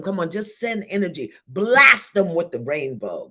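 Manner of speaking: ordinary talk, nothing marked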